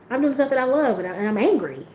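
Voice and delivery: ordinary delivery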